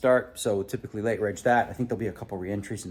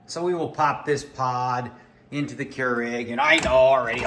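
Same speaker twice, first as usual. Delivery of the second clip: ordinary talk, nothing marked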